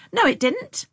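high, loud, sharp denial; sharp scolding. A narrator's reading. Sister contradicting Tom's teasing, scolding sharply. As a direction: snap back at him, pitched high. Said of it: "Anne scolded sharply".

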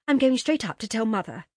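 a sister threatening to tell on her; childish threat. Acted. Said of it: "just as they had used to say on those occasions".

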